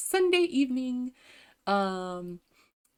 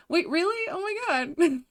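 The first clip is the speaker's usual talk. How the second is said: high, gasping surprise; real surprise, pitched way up; astonished delight; 9 semitones higher, 4 dB louder than usual. Real, not acted. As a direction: gasp and ask in happy disbelief. Reacting to praise from chat.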